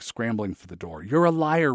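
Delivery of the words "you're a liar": angry yell. A narrator's reading. Eric shouting at the boy.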